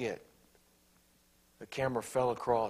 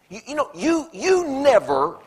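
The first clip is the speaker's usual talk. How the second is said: hammed-up shrill-free accusing tone; mock accusing spouse; 14 semitones higher, 13 dB louder than usual. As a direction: stretch out the blame. Acted accusation, high slow.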